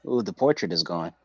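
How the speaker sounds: usual speaking voice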